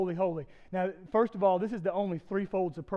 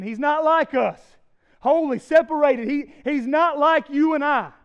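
louder, higher awed proclamation; angelic declaration; 8 semitones higher, 12 dB louder than usual. Acted. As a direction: proclaim God's otherness like an angel. Angels saying to one another.

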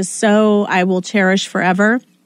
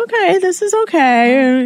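mock McConnell; 7 semitones higher than usual. Quoting McConnell mockingly.

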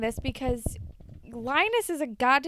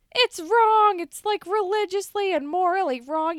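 much higher, louder preachy insistence; mock moralizing Spinner; 10 semitones higher, 5 dB louder than usual. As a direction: parrot a moral lecture. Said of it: "clings to the, like".

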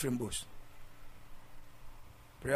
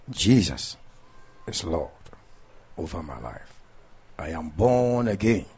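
loud fervent prayer, drawn out; preaching prayer; 3 semitones lower, 6 dB louder, slower than usual. Acted. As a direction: proclaim each phrase to the crowd. Leads sinner's prayer.

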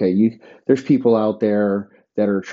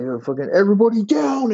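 mock robber yell; 13 semitones higher, faster than usual. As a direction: yell it like a gunman storming in. Imagined gun threat, calling out.

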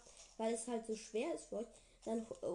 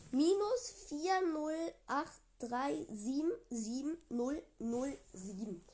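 langsam diktiert; 4 semitones higher, 5 dB louder, slower than usual. Real, not acted. Diktiert Seed zum Mitschreiben.

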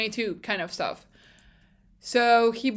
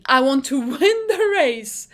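louder, emphatic mock radio demand; impassioned quote; 3 semitones higher, 7 dB louder than usual. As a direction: push it out like a determined driver on the radio. Quoting a driver's radio demand.

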